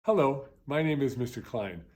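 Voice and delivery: ordinary delivery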